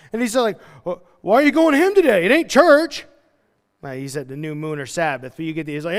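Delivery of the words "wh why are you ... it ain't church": loud, high mock husband voice; hammed-up baffled husband; incredulous husband. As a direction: question her loudly in disbelief. Acts puzzled husband questioning wife.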